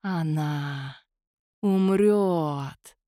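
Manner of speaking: slow ghostly hiss of shadows. A narrator's reading. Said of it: "зашипели тени".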